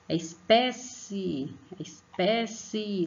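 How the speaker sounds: "espécie" called slowly, "pé" stretched; calling-out syllable stress. Shown as a demonstration. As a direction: lean long on the "pé" of "espécie". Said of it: "como se estivesse chamando alguém".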